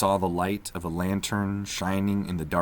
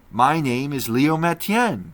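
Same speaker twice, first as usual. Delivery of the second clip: Leo's voice; 8 semitones higher, 8 dB louder than usual. A narrator's reading. Leo introduces himself.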